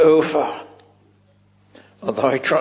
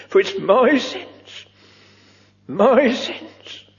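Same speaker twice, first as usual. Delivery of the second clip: my pushed higher each time; stress on my; 5 semitones higher, slower than usual. Shown as a demonstration. Preacher lays the emphasis on his own sins, stressed. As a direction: hit my hard in both. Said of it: "lay the emphasis there".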